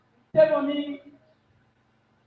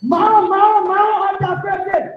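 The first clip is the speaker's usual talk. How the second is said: higher and louder, eager blurting; excited calling out; 6 semitones higher, 5 dB louder than usual. Real, not acted. Contestant blurting a guess at the quizmaster, excited call.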